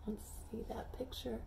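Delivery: soft unvoiced aside; a breathy aside while turning the page; whisper. Real, not acted. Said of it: "aside to children, whispered".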